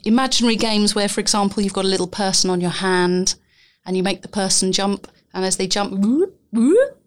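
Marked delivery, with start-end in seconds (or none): 5.9-7.0 sliding whoops, up and down; exaggerated cartoon intonation. Shown as a demonstration. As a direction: swoop your voice like a jumping puppet. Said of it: "you make your voice go up and down".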